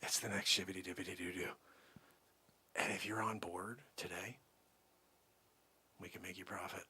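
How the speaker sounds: very soft, low, confidential pitch; hushed secret. Acted. Salesman secretly pitches profit to Joe Ellen, hushed. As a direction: murmur it like insider information. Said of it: "I have to keep my voice down".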